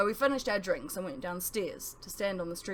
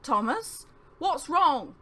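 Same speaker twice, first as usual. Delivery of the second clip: higher and louder, panicked question; rising panic as he calls to him; alarmed; 8 semitones higher, 8 dB louder, much slower than usual. Acted. Friend falls with an arrow.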